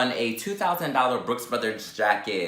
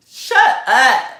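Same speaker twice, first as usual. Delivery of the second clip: much higher, loud shout; mock furious yell; 10 semitones higher, 9 dB louder than usual. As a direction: yell it to silence someone. Imagined confrontation with the rival.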